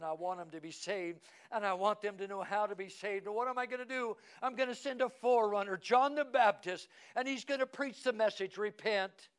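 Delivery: loud, high-pitched pulpit proclamation; full-force preaching. Acted.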